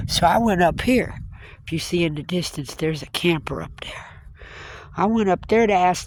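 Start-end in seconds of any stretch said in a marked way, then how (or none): 1.7-4.1 hushed aside about the camper; whispered aside. Real, not acted. Pointing out the distant camper to viewers, whispered. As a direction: drop to a whisper while pointing.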